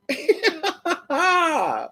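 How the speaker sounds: high, falling cackle; hearty laughter. Real, not acted. Enjoying the Larry story, high falling laugh. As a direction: laugh out high, then trail down.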